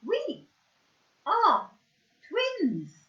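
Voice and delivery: high, piping little monkey voice; high, bright puppet chirp; squeaky puppet voice. Pretend. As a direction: announce it like a proud toy monkey. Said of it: "you can do a little performance and go".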